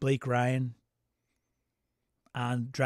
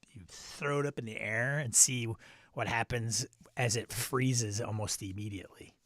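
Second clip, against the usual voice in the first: normal talking voice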